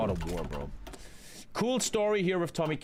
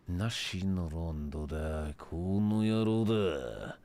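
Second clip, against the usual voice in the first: mock deep voice; 9 semitones lower, slower than usual. Mock reading of focus name.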